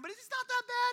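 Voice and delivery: high-pitched mock excuse; defensive minimizing. Believer justifying slipping standards, high-pitched defensive excuse. Acting someone making excuses.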